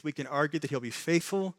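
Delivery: ordinary talk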